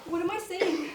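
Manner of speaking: usual speaking voice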